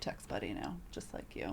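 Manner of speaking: low, soft, whispered; whispered confession to her friend; sheepish whisper. Real, not acted. Wry confession to co-host.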